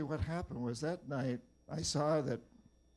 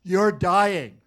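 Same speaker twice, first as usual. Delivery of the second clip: loud blunt shout of "You're dying"; shaman shouting a death sentence; shaman's verdict; 6 semitones higher, 15 dB louder than usual. Retold or imitated. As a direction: bark it into his face like a fist shake. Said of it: "he shakes his fist in my face and he says".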